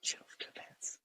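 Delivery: whispered under the breath; breathy whispered repeat. Shown as a demonstration. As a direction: whisper chuffed to bits softly. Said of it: "whisper repeat".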